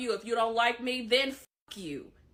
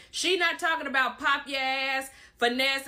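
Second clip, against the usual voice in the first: normal talking voice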